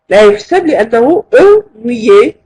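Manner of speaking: usual speaking voice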